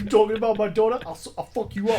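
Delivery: loud high furious yell; angry shout. Pretend. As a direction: threaten like an outraged dad. Acted threatening parent.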